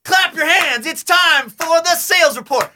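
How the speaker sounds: high, slow hype voice; hype presenter. Pretend. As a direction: pump up the room like a corny host. Mock hype presenter at meeting.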